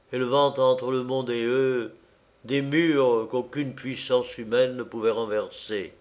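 ordinary delivery, nothing marked

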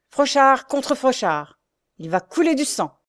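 slow, loud, high proclamation of battle; furious roar. Acted. Jacques opening the duel with his brother, furious. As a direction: roar it out deliberately. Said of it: "rugit Jacques furieux".

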